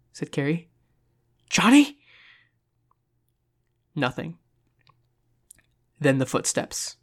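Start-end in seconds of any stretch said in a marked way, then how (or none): 1.5-2.0 high, loud rising question; anxious, pitched-up cry; alarmed calling out. A narrator's reading. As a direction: call the name in growing panic. Calling for partner who went silent.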